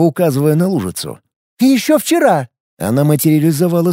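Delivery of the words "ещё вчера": put-on crazy girl voice.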